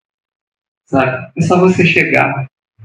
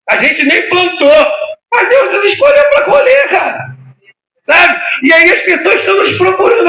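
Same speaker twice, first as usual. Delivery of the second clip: preaching at full force. Acted. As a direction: cry out the call to the harvest with fervor. Pregação no auge, gritando. (pregação inflamada, aos gritos e com a voz embargada)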